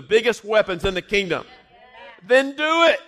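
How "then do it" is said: urgent shout. Real, not acted. Preacher urging the crowd.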